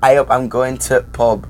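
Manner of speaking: A up, I'm going to pub with a Yorkshire ay oop and pub with a short u; Yorkshire A up, dropping the before pub. Shown as a demonstration. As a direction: model the Yorkshire line for her. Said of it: "teach you how to ... in Yorkshire".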